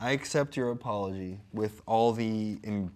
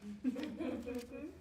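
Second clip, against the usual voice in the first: high laugh; 12 semitones higher, 11 dB quieter than usual. Real, not acted. Laughing after reading.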